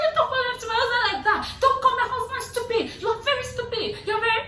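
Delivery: high, loud, fast mock of the angry wife, laughing; mock shrieking wife. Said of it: "And she was like".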